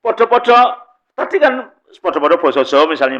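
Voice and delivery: ordinary talk, nothing marked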